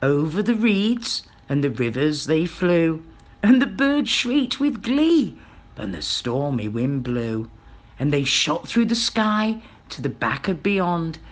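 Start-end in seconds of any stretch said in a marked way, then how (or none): 3.4-5.4 lifted, higher storytelling pitch; gleeful narration. A narrator's reading. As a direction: let the bird's glee lift your voice. Narrator colours the bird's gleeful shriek.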